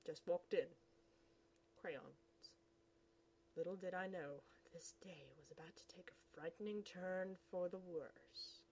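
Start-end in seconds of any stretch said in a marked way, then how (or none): 4.7-8.6 spooky whisper. Acted.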